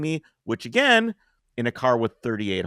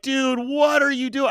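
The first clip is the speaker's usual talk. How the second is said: slow, raised voice in disbelief; incredulous high yell; 14 semitones higher, slower than usual. Retold or imitated. Man scolding the attacker in front of his child, incredulous yell. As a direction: call out to him, stunned. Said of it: "I'm like".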